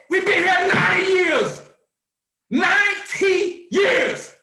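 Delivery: high, loud shout with the second line drawn out slowly. Real, not acted. Preacher-style host roaring to his listeners, loud shout. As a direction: roar it out, stretching the second line. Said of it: "shouted climax of the rant".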